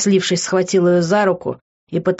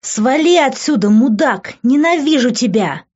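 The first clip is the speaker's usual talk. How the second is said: yelling; 7 semitones higher, 4 dB louder than usual. A narrator's reading. Girl screaming at the man she throws shoes at, yelling. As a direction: yell it in an outraged higher voice. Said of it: "вопила она".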